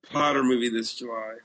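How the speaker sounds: ordinary talk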